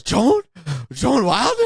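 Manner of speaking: high, gasping fan voice; star-struck thug suddenly recognizing her; excited recognition. Retold or imitated. Henchman recognizing the famous author, high and star-struck. Retelling gangster recognizing Joan.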